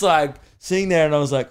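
ordinary delivery

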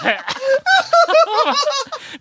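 big laugh. Real, not acted. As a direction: laugh hard at yourself. Laughing at his own letdown.

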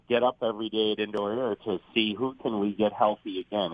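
normal talking voice

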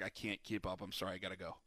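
mock exhausted mumble, fading out; defeated quitter. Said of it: "He's like".

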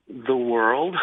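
chuckling through the words; laughing. Real, not acted. Man answers God's question about his identity, laughing. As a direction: let a laugh break in as you answer.

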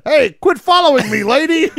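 annoyed footprint voice. Acted. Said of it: "he's like".

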